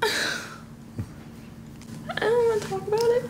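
high, quick, choked-up voice; tearful. Real, not acted. Mother halting her story of the scare, tearful. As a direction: squeeze the words out through real tears. Breaks down retelling baby's scare.

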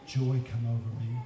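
ordinary talk, nothing marked

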